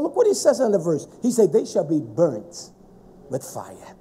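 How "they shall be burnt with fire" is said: soft, slow grave reading; hushed. Real, not acted. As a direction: let it sink low and quiet. Reading the burning verse.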